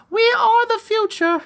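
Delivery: put-on very high, squeaky entitled kid; mocking children demanding to be served; mock bratty kids. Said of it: "It's, it's the children themselves".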